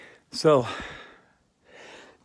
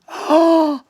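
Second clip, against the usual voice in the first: loud high delighted gasp; excited gasp; 9 semitones higher, 16 dB louder than usual. Acted. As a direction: gasp with glee. Excited gasp before Hello Kitty mode.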